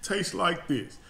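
fighting back tears of pride in his sister; weepy, breaking voice; real crying. Real, not acted. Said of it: "it's making me cry every time I eat a bite".

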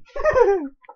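high wheezing laughter. Real, not acted. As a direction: keep laughing hard. Laughing fit.

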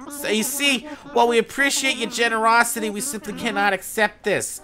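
put-on high, fussy old owl woken from sleep; flustered owl curator, high and loud; voiced sleepy owl.

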